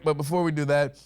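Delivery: normal talking voice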